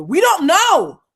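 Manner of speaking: loud, high indignant protest; exasperated outburst. Real, not acted. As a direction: burst out in disbelief. Host exasperated at people's assumptions.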